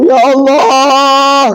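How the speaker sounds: high, very slow hammed-up groan; drawn-out mock wail. Husband pulling ring from dead wife, mock wail. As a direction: stretch it out like a fake sob.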